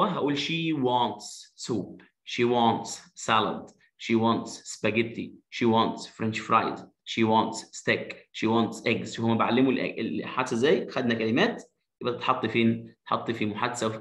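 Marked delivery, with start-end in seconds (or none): 0.4-9.1 slow, clear third-person drill. Shown as a demonstration. Teacher modelling she wants, slowly. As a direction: stretch each word out for the kids. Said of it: "لو عايز أقول هو".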